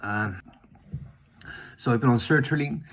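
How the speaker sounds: ordinary talk, nothing marked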